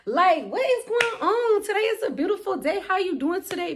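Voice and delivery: high, sugary mock friendliness; sweet on top, needling underneath; petty fake sweetness. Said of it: "I'm so petty".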